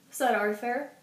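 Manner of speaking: ordinary delivery, nothing marked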